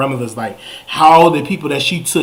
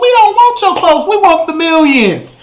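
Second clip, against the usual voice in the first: indignant rant; 9 semitones higher than usual. Retold or imitated. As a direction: scold her in disbelief, way up high. Demanding the money.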